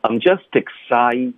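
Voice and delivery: slow, careful "I'm just excited"; slow demo. Shown as a demonstration. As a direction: spell it out slowly for learners. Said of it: "这是需要有一定的力度".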